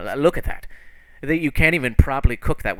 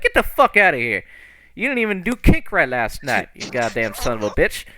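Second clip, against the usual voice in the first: acted furious telling-off; angry berating; 3 dB louder than usual. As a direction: drive him off with scorn. Character berates man on ground.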